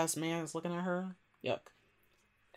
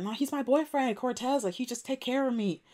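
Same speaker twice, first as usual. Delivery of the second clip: high-pitched imitated teen protest; higher, pleading-style retold excuse; defensive protest; 6 semitones higher than usual. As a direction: voice a daughter defending herself, pitched up. Voicing daughter defending her boyfriend.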